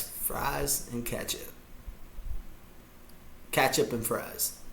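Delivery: usual speaking voice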